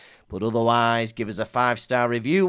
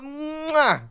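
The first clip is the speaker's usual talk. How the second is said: air kiss; 12 semitones higher than usual. Pretend. Gives a kiss as well.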